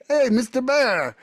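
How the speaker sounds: high, sugary call to a wild bear; sweet greeting to a bear. Retold or imitated. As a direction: coo at the bear like a friendly pet. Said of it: "And so I'm like".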